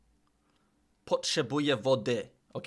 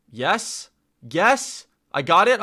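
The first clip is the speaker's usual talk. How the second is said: excited; 4 semitones higher, 9 dB louder, slower than usual. Real, not acted. Learner checks a guess with chat.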